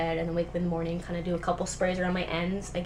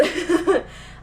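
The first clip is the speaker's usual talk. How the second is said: a bright burst of real laughter, higher and louder; short laugh; 10 semitones higher, 7 dB louder than usual. Real, not acted. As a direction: laugh at herself, then move on. Laughing at her own words.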